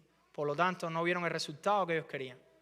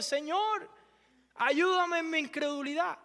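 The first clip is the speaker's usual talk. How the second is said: crying out; 10 semitones higher, slower than usual. Acted. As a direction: let the plea burst out. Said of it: "como que gritó, se desahogó".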